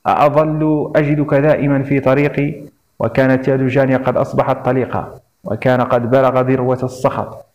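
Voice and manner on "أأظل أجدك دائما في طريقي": ordinary delivery, nothing marked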